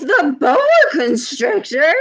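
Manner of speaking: a mom stunned by the news of a snake; playful mock shock; astonished mom. Mom hears about the snake.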